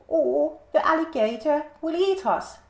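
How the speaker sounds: a little mouse fearing the alligator; squeaky frightened mouse. A narrator's reading. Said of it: "squeaked the mouse".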